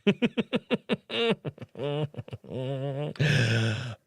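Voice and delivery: real long, rolling chuckle; long laugh. Real, not acted. Streamer amused by a viewer's comment, long laugh. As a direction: laugh on and on, tailing off.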